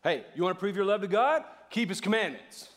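forceful mock legalist; demanding challenge. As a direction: throw it down as a test. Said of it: "Anybody read it that way before?".

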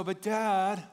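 imitating a whining daughter; whiny kid. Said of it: "that's how they talk when I retell it".

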